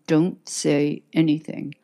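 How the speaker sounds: usual speaking voice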